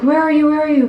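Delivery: high, loud mock panic; imitating freaking out. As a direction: fire it out in imitated alarm. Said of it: "like, freaking out".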